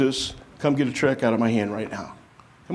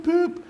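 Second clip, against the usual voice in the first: high quick "boop" like a checkout scanner; mock electronic beep. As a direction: chirp it like a register beep. Imitating checkout scanner beeps.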